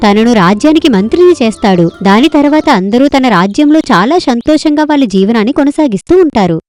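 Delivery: plain reading voice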